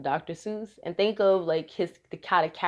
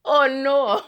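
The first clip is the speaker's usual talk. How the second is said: mock panic; 7 semitones higher, 4 dB louder than usual. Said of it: "I was like".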